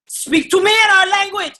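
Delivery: high, loud demanding relative. Retold or imitated. Dominican relative ordering the guest to speak Spanish. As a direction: insist like a pushy aunt.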